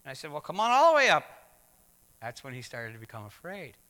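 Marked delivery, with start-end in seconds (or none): 0.3-1.2 loud encouraging call upward, pitched high. Retold or imitated. Father calling up to his young son on the ladder, loud and encouraging. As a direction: call him up the ladder, full voice.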